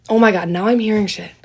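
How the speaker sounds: normal talking voice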